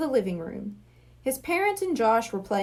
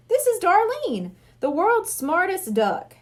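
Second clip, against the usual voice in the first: proud showman; 7 semitones higher than usual. Acted. Hypnotist presents duck proudly.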